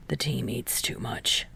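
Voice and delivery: low, whispered grumble; grumbling. A narrator's reading. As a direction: grumble it under your breath. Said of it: "Kageyama grumbles".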